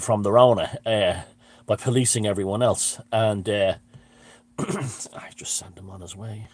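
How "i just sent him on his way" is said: slow, breathless whisper; a whispered, satisfied confession; whispered aside. Real, not acted. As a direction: drop to a secret whisper. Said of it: "quiet aside".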